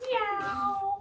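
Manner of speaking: high meowing cat. Shown as a demonstration. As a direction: meow like a cat. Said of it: "Can you meow like a cat?".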